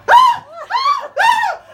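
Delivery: very high, very loud repeated shrieks; imitated shrieks. Woman on the train discovering the vomit, shrieking. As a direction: shriek like someone who just saw vomit at her feet. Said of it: "starts going".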